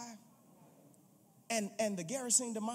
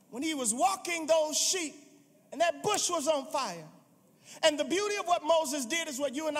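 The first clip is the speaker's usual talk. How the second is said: loud, high, driving preacher's climax; preaching at full force; 7 semitones higher, 6 dB louder than usual. Acted. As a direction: build the congregation up, loud and fervent.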